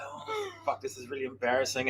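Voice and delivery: very soft, under the breath; embarrassed. Retold or imitated. Passenger to himself under everyone's stares, quietly embarrassed. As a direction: shrink into a private aside.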